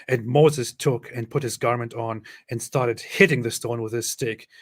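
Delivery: ordinary delivery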